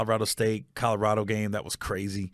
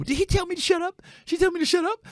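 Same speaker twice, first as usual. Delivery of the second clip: much higher, prissy put-upon voice; mock offended woman. Drunk woman asking the room if he told her off, high and offended. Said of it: "she started telling other people".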